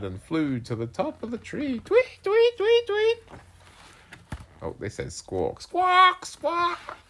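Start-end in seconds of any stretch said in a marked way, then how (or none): 1.8-3.2 very high, loud chirps; rapid panicked tweeting; frightened bird tweets. Acted. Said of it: "which frightened Bird". 5.6-7.0 very high, loud squawks; shrieking storybook bird; screeched bird squawk. Acted. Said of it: "screeched Bird".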